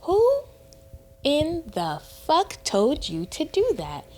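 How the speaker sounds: drawn-out, high-pitched indignant question; outraged disbelief. Retold or imitated. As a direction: stretch it out in incredulous outrage. Retelling her outraged confrontation.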